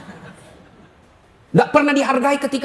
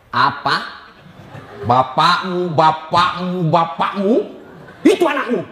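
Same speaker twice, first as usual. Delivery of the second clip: mock angry wife; 4 semitones lower, slower than usual. Wife retorts angrily in story.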